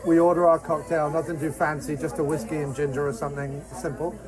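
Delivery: usual speaking voice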